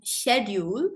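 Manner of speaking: British "schedule" starting with sh; British pronunciation. Shown as a demonstration. Teacher showing the school-learned way, British "schedule". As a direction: say "schedule" as "SHED-yool". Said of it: "we say words like".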